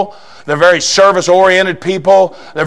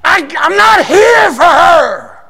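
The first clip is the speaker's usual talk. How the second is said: refusing shout; 9 semitones higher than usual. Retold or imitated. Preacher shouting as Jesus refusing the Gentile woman. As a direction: shout it high like a stubborn preacher. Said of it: "he says".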